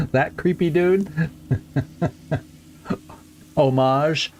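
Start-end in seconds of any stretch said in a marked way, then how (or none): 3.6-4.3 "Homage" as oh-MAHZH; French-style pronunciation. Shown as a demonstration. Player trying another way he's heard it, "Homage" as oh-MAHZH. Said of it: "I've heard it".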